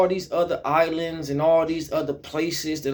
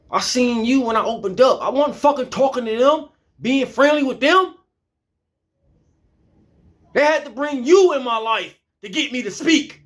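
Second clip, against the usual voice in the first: higher, rising and pressing harder; heated rant; 5 semitones higher than usual. Real, not acted. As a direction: push it up as the anger builds. Rant builds into heated venting.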